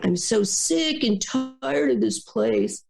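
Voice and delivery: slow, drawn-out whiny complaint; whiner's complaint. Retold or imitated. A coworker mocking a whiner's slow moan. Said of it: "I love this one".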